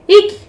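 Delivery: short, high eek; frightened squeak. Acted. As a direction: yelp a tiny mock squeal. Character squeals before grovelling.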